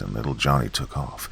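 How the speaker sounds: ordinary delivery, nothing marked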